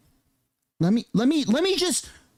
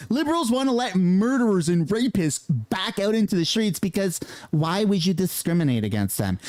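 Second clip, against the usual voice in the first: normal talking voice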